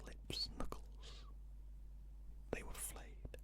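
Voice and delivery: plain reading voice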